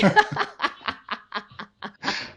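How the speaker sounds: a long, breathy real laugh; hearty laugh. Real, not acted. Laughing at her own story.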